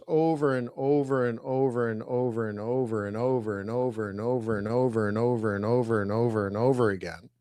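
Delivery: low, running loop of words without a break; droning repetition. Shown as a demonstration. Trainer stressing endless practice to students, droning repetition. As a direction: roll it on and on, speeding up toward the end.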